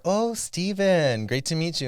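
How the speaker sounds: high, slow, overly friendly greeting; a sing-song mock of a stranger; mock cheery stranger. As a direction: imitate someone cheerfully getting his name wrong.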